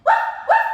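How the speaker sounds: high loud woof woof; dog bark. Shown as a demonstration. Teacher imitates dog bark.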